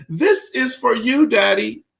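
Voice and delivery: a hint of a small child offering a gift; little girl's voice.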